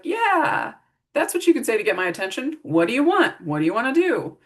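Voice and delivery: high-pitched enthusiastic "yeah", then playful eager questions; lighthearted, fun role play. Shown as a demonstration. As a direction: reward them with upbeat, playful eagerness. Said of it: "making it lighthearted and fun".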